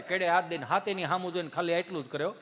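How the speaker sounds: ordinary delivery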